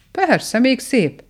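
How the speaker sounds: high, slow, huffy retort; slightly offended. A narrator's reading. Mole answering doubt about his tunnels, slightly offended. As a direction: draw it out, a little miffed. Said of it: "mondta kissé sértődötten a vakond".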